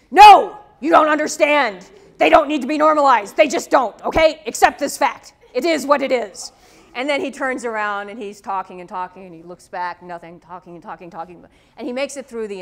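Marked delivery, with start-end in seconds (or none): 0.1-6.5 loud, forceful insistence, faster at first; Wannier insisting. Retold or imitated. Wannier loudly insists to the student: no normalization, loud. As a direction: hammer it home, won't hear an argument. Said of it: "And he's like".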